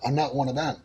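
normal talking voice

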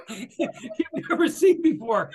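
laughed through slowly; laughing, slow. Real, not acted. Teacher amused retelling Morpheus, laughing through it. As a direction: chuckle the words out, slow and high.

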